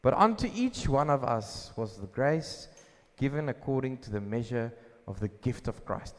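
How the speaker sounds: ordinary talk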